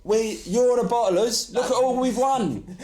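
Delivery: high, drawn-out Cockney retort; mock Cockney accent. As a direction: imitate an indignant Cockney fan hitting back. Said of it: "in a Cockney accent".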